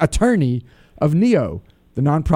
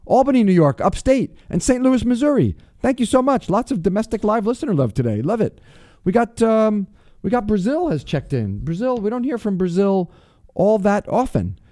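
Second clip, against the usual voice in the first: radio shout-outs; 8 semitones higher than usual. Acted. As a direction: call out each city with a showman's lift. Shout-outs to listener cities.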